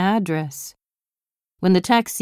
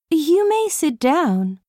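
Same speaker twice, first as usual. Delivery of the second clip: princess voice; 8 semitones higher than usual. Acted. Anne granting leave regally.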